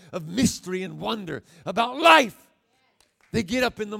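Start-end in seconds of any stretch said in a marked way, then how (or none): 2.0-2.4 high, loud punch on one word; shouted word. Real, not acted. Preacher to congregation, one shouted word. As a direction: belt the word out.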